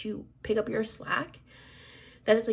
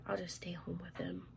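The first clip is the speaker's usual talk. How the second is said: whisper; 4 semitones lower, 14 dB quieter than usual. Retold or imitated. Husband's quote, hushed.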